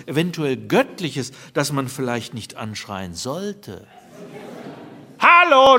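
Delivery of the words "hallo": high, loud hello; shouted. Retold or imitated. Wife shouting into the dead line, loud. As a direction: shout into the ether. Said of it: "schreit sie den Äther an".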